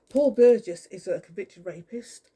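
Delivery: real anger breaking out loud; loud accusation. Real, not acted. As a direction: declare it hard and slow to everyone. Angry accusation against named stalker.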